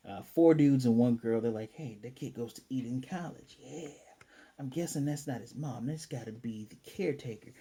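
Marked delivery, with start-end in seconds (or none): 1.7-7.5 hushed imitation of a ne'er-do-well; put-on punk voice. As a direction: play a scheming street punk, kept quiet. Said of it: "That's just my interpretation of the voice".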